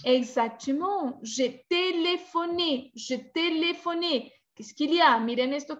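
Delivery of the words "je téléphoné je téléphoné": TÉLÉPHONÉ slow and high, every É sounded; careful model pronunciation. Shown as a demonstration. Teacher confirming a student's answer, slow careful model pronunciation. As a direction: stretch "téléphoné" syllable by syllable, ending on a clear "ay". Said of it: "Una palabra con tres acentos".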